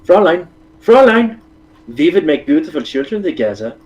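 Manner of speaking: put-on over-the-top German accent, high calls. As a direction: ham up a German suitor. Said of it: "I can do better German accent than you".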